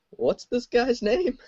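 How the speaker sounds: lifted, slow, high-pitched read. Retold or imitated. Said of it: "She just asked".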